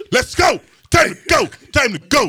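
high, urgent shouts to hurry someone up; mock shouting. Friend rousing him two hours after sleep, mock shouting.

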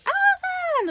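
high, far-carrying call of a name; mock excited call. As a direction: hail a friend across the pool. Someone calls her name in pool.

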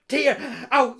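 ordinary delivery, nothing marked

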